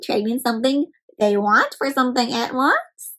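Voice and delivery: usual speaking voice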